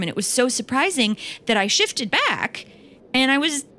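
normal talking voice

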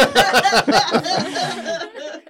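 high giggle fit; giggling. Real, not acted. Player giggling at his own failed roll, high laughter. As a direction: dissolve into giggles. Laughs after rolling badly.

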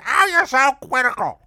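put-on high, loud, offended old landlady; Mama Jerry. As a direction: cut in shrieking as the old lady. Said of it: "And she talked like this".

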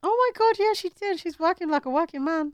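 high squeal of excitement; excited. Real, not acted. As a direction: gush about her news. Hyping friend's new job.

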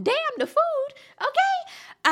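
high, loud mock cry; mock wail. Real, not acted. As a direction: wail it out in playful outrage. Mock cry over restaurant money.

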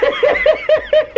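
sudden high cackle; high laugh. Real, not acted. Host bursts out laughing at the uncle's reaction, high cackle.

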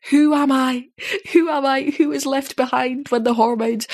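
normal talking voice

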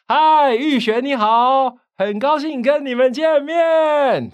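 very high, loud, stretched-out ending; rally greeting for ten thousand. Shown as a demonstration. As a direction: hail a huge crowd like a revival preacher. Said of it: "他甚至还会拉长尾音". (像布道大会一样拉长尾音高喊)

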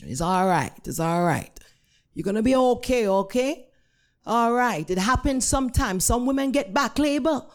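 reassuring Jamaican nurse; Jamaican-accented reassurance; thick Jamaican accent. Retold or imitated. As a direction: soothe and explain in thick Jamaican. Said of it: "in a thick Jamaican accent".

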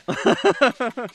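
hearty guffawing laugh. Shown as a demonstration. Host illustrating laugh types, guffaw. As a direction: let out a big guffaw. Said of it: "Or a guffaw?".

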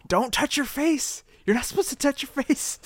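high-pitched urgent warning. Real, not acted. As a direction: warn him urgently, voice up high. Warning the host mid-burn.

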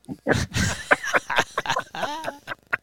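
wheezing silent laugh; breathless high-pitched laughter. Real, not acted. Laughs after the story.

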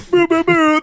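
high-pitched mock air horn; air horn imitation. Mocking a therapist's comeback, high air horn imitation. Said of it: "she played an air horn".

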